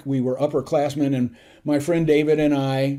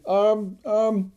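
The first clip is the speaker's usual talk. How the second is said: higher, halting question; tentative and bewildered, pitched up; lost, unsure; 6 semitones higher, slower than usual. Retold or imitated. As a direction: imitate a confused junior cadet groping for words. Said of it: "looked lost and he said".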